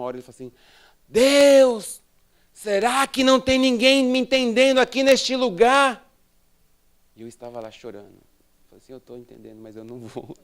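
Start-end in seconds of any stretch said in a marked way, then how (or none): 1.1-6.0 high, loud cry to God; imitating a preacher's raised-voice plea. Acting out preacher crying out to God. 8.9-10.4 low, very soft private admission; quiet, reluctant little refusal; meek reply. Retold or imitated. Meek inner reply after the shout.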